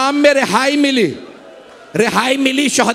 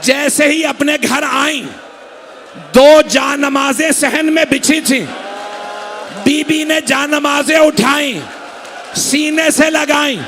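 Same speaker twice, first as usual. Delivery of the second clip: impassioned majlis preaching; 4 semitones higher than usual. Acted. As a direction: build the grief higher with each line. Preacher building the lament.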